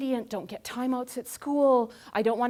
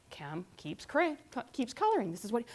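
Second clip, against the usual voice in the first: normal talking voice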